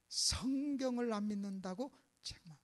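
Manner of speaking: grave, drawn-out preaching undertone; hushed emphasis. Real, not acted. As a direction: let the point sink in quietly.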